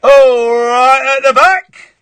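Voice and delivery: drawn-out, high, loud hail; mock calling out. Host hailing a caller on a bad line, mock calling out. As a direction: holler it slowly as if to the back row.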